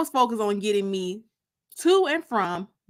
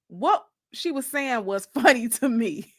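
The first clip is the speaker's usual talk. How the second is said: plain reading voice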